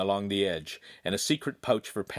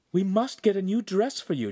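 exclaiming; 10 semitones higher, 3 dB louder than usual. A narrator's reading. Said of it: "Giulietta exclaimed".

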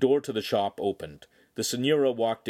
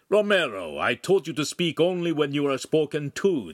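louder, higher scolding; chiding; 7 semitones higher, 5 dB louder than usual. A narrator's reading. Master chides apprentice for speaking out, raised voice. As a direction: rebuke the apprentice firmly. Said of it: "the master chided".

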